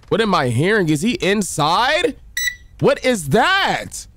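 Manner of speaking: high, slow and frightened; scared horror game streamer. Real, not acted. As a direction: gasp it out, spooked. Hears a noise in horror game.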